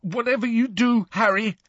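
high, urgent insistence; fierce. A narrator's reading. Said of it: "said Neville fiercely".

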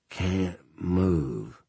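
weak, effortful words through sedation; strained, drugged. Acted. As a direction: force it out, barely able to speak. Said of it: "Saying even that required a huge effort".

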